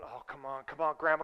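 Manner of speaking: low, soft pleading under the breath; muttered impatience. Retold or imitated. Muttered impatience before the yell.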